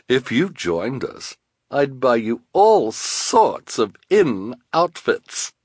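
high, slow, affected luring; enticing villainess. Acted. Esme bribing Carmelita with outfits, enticing. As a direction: tempt her with drawn-out promises.

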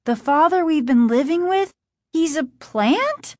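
high, incredulous question; shocked cry. A narrator's reading. Margaret learning her father is a plant, shocked cry. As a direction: question in disbelief, rising sharply at the end. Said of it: "Margaret cried".

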